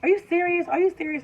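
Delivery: high, repeated mock disbelief; retold incredulous mom, insistent and high; mom freaking out. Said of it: "My mom immediately kind of freaks out".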